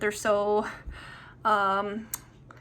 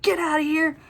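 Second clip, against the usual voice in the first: sharp high yell at an animal; 6 semitones higher than usual. Retold or imitated. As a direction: bark it out to scare it. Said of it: "but I yelled".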